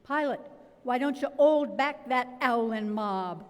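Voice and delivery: a hammed-up cockney preacher calling out; Father Vaughan cockney. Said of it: "Father Bernard Vaughan's droll eyes and cockney voice".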